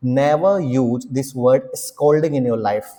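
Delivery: "Never" stressed, each word spaced out slowly; slow stern warning. Shown as a demonstration. Teacher warning students never to use the word, slow and stern. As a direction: lay down the rule word by word, very slowly. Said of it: "stern slow warning".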